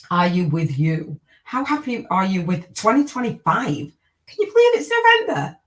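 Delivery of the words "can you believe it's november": amazed. Real, not acted. Amazed at the date, to viewers.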